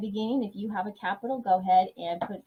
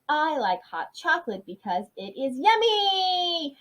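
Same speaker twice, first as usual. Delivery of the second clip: higher, slow, excited read of the sentence; 6 semitones higher, slower than usual. Shown as a demonstration. Teacher demonstrating how an exclamation mark sounds, excited. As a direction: say it like you really mean it. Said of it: "So it's like saying it like this".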